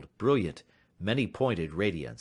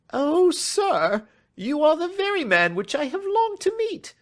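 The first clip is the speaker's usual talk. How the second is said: high, loud, excited cry of a nervous little man; eager cry; 8 dB louder than usual. A narrator's reading. Nervous clerk greeting the detective, high excited cry. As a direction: reach out to him with trembling eagerness. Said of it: "cried the little fellow".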